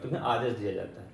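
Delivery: usual speaking voice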